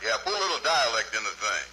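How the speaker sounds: ordinary talk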